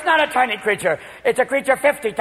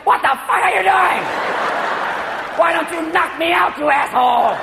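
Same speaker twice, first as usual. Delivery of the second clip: furious wife; 5 semitones higher than usual. Retold or imitated. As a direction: scream it at her husband. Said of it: "She's looking at you like".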